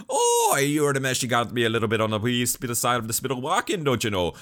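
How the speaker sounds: loud mock Irish-Scottish gibberish; high, hammed-up Irish-Scottish brogue; Gene. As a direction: garble it in a thick fake Irish-Scottish accent. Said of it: "with your goddamn accent".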